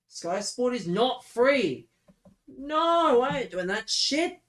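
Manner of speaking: furious outburst at the suggestion; angry shout. Real, not acted. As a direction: shout it down in disbelief. Angry refusal to pay.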